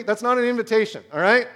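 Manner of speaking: ordinary talk, nothing marked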